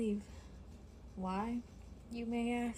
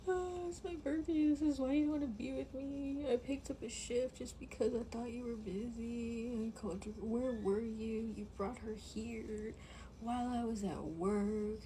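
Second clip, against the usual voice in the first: mock wailing; 3 semitones higher than usual. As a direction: wail it out, hurt and accusing. Crying accusation at boyfriend.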